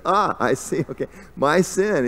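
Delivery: loud, high-pitched mock enlightenment; Krishna. Playful god drives the pun home to the man, loud and high. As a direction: play up the sudden understanding, teasingly. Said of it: "Krishna's playful, Krishna does".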